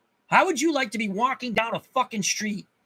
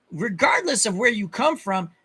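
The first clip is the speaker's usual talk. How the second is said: normal talking voice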